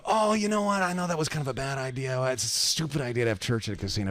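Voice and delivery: high-pitched, hurried excuse; put-on flustered retreat; nervous backpedalling. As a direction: talk your way out fast. Pastor backpedalling before mobster.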